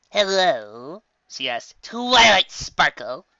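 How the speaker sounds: high, drawn-out call at the door. Acted. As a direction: call into the wagon, slowly. Trixie knocking at the wagon.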